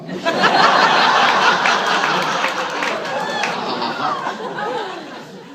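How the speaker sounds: high, long evil cackle; evil laugh. Acted. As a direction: devilish cackle after the line. Devilish laugh.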